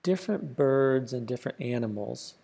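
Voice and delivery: unhurried meditation voice, long pauses between phrases; softly spoken, spacious relaxation guide; guided meditation. Acted. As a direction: guide the listener gently inward, leaving space after each phrase.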